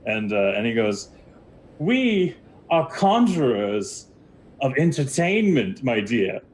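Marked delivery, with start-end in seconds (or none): 1.8-6.4 high, slow showman flourish; grand lizard wizard. Acted. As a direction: announce it grandly, stretching the words. Game master voices the wizard.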